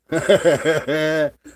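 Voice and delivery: big mocking laugh; a loud scornful laugh out loud; loud burst of laughter. Real, not acted. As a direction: laugh hard at him. Laughing at rival's injury.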